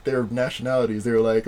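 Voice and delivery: ordinary talk, nothing marked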